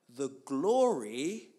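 GLORY loud and stretched out long; emphatic proclamation. Acted. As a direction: swell hugely on "glory", drawing it out. Proclaims climax of scripture verse.

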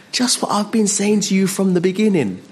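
high, emphatic reply; high insistent answer; Jesus. Acted. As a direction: pitch it high and fall away at the end. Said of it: "and he says".

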